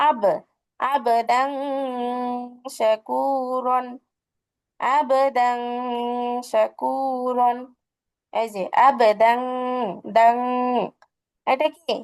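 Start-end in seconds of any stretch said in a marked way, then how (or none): 0.1-7.6 আবদাং with a long nasal "ng", then drawn-out শাকুরন; sustained hum in the nose ending আবদাং; ikhfa ghunna. Shown as a demonstration. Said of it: "সবগুলো একইভাবে দেখো". 8.9-10.4 আবদাং ending in a held nasal "ng"; slow আবদাং with a long nasal close; ikhfa ghunna. Shown as a demonstration. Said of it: "ইকফা গণনা".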